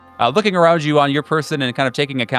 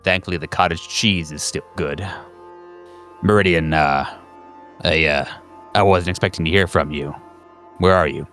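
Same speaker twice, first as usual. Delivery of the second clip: lower-pitched, guarded operator voice; agency phone operator; 6 semitones lower than usual. Acted. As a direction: answer the coded call in a low register. Game master voices call operator.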